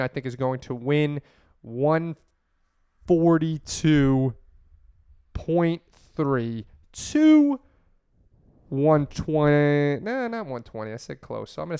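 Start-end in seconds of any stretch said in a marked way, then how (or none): 1.6-10.0 drawn-out suspense; slow dramatic reveal. Acted. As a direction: slowly announce each number. Drawn-out score prediction.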